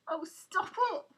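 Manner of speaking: high, loud sobbing plea. A narrator's reading. Wife begging her taunting husband, sobbing loudly. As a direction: cry out through tears for him to stop. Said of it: "sobbed Helen".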